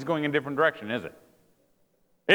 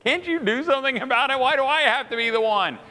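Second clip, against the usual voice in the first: higher, whiny-style protest; mock exasperation; 8 semitones higher than usual. Husband answering his wife's complaint, mock exasperated. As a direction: act out a put-upon husband, pitched up.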